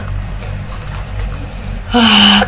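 breathy unvoiced heavy sigh; long exasperated sigh. Real, not acted. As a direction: let it all out in a sigh. After the outburst.

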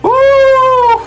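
loud, very high drawn-out "woo"; celebratory whoop. Real, not acted. Player cheering the finished voice lines, celebratory whoop.

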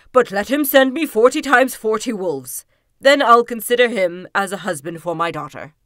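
booming royal demand, very loud; commanding king. A narrator's reading. King setting the suitor a test, loud and commanding. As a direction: lay down the condition as a loud monarch.

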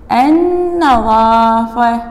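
النظافة with the lam silent and a doubled nun, said slowly; careful pronunciation. Shown as a demonstration. Teacher showing pupils how to say النظافة. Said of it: "قلنا اللام ساكنة، النون مشددة".

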